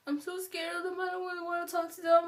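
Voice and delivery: lightly sung, mock frightened whimper; imitating scared friend. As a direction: mimic a timid friend confiding. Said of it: "my friend is coming up to me and being like".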